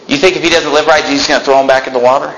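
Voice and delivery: normal talking voice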